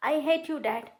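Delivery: high, loud outburst; furious cry. Acted. Betrayed daughter screams at her father in fury. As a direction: hurl it at him in rage.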